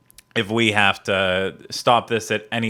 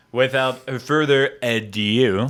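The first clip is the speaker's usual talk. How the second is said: mock announcer; 4 semitones higher, slower than usual. Mock grand show opener.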